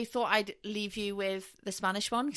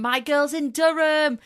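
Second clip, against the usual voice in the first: proud, drawn-out cheer for her team; slow it down and beam with pride; excited; 8 semitones higher, 8 dB louder than usual. Real, not acted.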